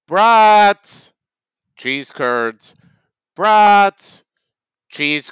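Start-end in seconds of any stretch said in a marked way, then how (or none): 0.1-1.1 high, loud hawker's cry of brats; vendor's call. Acted. Sausage vendor shouting to passing crowd, loud hawker's cry. Vendor calling out his wares. 3.4-4.2 high, loud hawker's cry of brats; vendor's call. Acted. Sausage vendor shouting to passing crowd, loud hawker's cry. Vendor calling out his wares.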